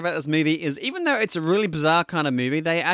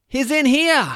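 higher, louder mock yell calling the family; kid yelling out; 9 semitones higher, 6 dB louder, slower than usual. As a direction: call out to give the man away. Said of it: "he yells out".

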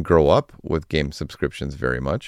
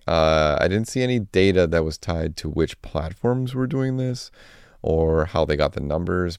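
normal talking voice

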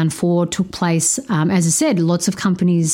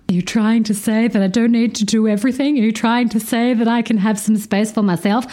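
higher, mock-astonished inner voice; mock realization; 5 semitones higher than usual. Mocking herself to the podcast audience, higher. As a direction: act out the penny dropping, a little higher. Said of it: "And I'm like".

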